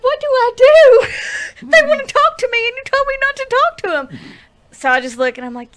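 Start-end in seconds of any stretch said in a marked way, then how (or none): 0.0-4.2 much higher, louder, whiny near-crying plea; mock panic. Asking her boyfriend for help, mock panicked whine. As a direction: whimper it like a helpless kid.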